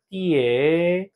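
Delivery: 띠에라가 drawn out, 띠에 held apart from 라; split into syllables. Shown as a demonstration. Teacher dividing a word into syllables, slow and stretched 띠에라. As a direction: slowly separate 띠에 and 라. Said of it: "띠에라를 음절 분해하면".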